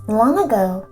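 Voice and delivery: plain reading voice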